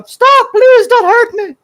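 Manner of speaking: very high, loud, drawn-out begging; hammed-up squealing victim voice; mock terrified plea. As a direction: beg for mercy in exaggerated falsetto. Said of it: "Would I also do this exact same thing?".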